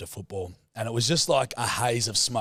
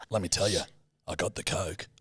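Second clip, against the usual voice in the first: usual speaking voice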